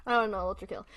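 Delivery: usual speaking voice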